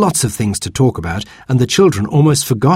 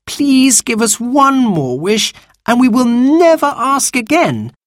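put-on high, earnest child's plea; imploring, high-pitched promise; begging; 4 dB louder, slower than usual. A narrator's reading. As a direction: beg with stretched-out words. Girl begs for one more wish.

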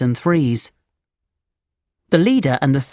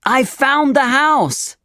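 excited shout; 9 semitones higher, slower than usual. A narrator's reading. Thief galloping up to the rest of the thieves, shouting. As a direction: call out the news in a high, stretched voice. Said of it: "he shouted".

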